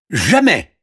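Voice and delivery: high, loud refusal; princess's indignant cry. Acted. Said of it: "cria la princesse". (cri de refus aigu)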